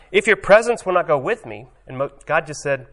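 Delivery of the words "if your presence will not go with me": Moses protesting. Retold or imitated. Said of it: "And he, Moses".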